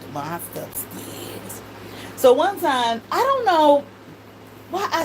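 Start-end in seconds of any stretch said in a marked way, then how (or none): 0.0-1.6 put-on much softer, slow, lowered little-girl murmur; mother imitates how her small daughter said it; small child voice. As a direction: say it like a shy toddler. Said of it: "That's how she would say it".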